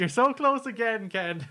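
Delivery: high teasing singsong. Real, not acted. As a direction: rib him playfully, way up high. Teasing co-host over flubbed word.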